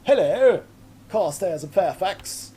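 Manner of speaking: hammed-up posh English accent, high and loud; exaggerated upper-crust English greeting, drawn out; English aviators. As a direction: call out heartily like a caricature British airman. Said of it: "They had the English aviators".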